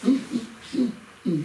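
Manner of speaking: closed-mouth "mm, mm" grunts; short hums in place of talking; wordless humming signals. Acted. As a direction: signal without words, lips shut. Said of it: "אני מדבר ב".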